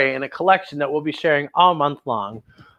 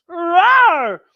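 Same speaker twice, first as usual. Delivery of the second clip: playful roar; 10 semitones higher, 3 dB louder than usual. Acted. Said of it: "She attacks".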